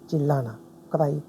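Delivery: ordinary talk, nothing marked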